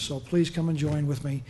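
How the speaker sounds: usual speaking voice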